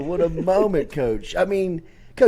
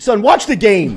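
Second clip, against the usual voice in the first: laughing retort; 4 semitones higher, 8 dB louder than usual. Retold or imitated. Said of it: "I said".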